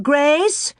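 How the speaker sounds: high mother's call, rising; mother searching, calling out. A narrator's reading. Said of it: "and she called".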